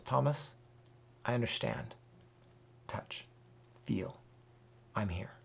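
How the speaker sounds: soft, gentle reassurance fading almost to nothing; Jesus gently invites Thomas to touch, softly tender. Acted. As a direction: speak it like a hand held out. Jesus comforting doubting Thomas.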